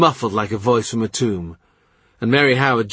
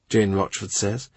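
ordinary delivery

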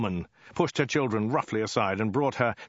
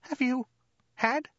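high falsetto, word by word; frightened woman's halting squeak; hesitant strangled squeak. A narrator's reading. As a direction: choke it out high and unsure. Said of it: "in a hesitant, strangled squeak".

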